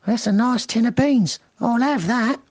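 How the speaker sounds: a greedy lady thief; high-pitched acquisitive burglar; intruder voice. Acted. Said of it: "The voice says".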